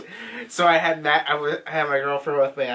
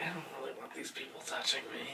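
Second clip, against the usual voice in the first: very soft, quick little mumble; timid reluctance; 15 dB quieter, faster than usual. Retold or imitated. Admitting he avoided being touched in haunted house, meek and hushed. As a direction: shrink away under your breath.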